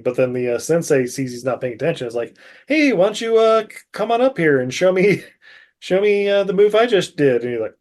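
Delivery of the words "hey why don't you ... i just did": high, mock-sweet challenge; lightly voiced taunting teacher; teasing instructor. Sensei calling student up.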